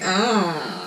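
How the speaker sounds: a gross eater's groan. Retold or imitated. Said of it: "the sounds that gross people make when they eat".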